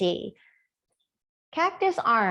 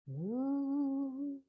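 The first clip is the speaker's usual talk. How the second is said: quiet breathy cow moo; a gentle moo under the breath; soft moo; 3 semitones higher, 9 dB quieter than usual. Shown as a demonstration. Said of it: "representing over there".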